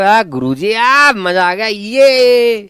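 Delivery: high, loud mock delight; imitated student delight. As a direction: ham up a thrilled student crying out guruji. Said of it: "तुम कहोगे".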